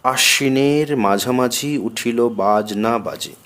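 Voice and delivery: slow, drawn-out verse line; lengthened verse vowels. Shown as a demonstration. Teacher reciting verse for the metre, vowels drawn out. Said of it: "অকার দীর্ঘ উচ্চারিত হয়".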